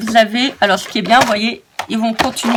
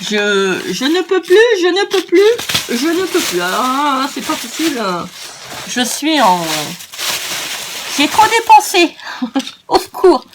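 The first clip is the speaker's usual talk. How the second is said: mock despair; 5 semitones higher than usual. Mock despair over spending too much.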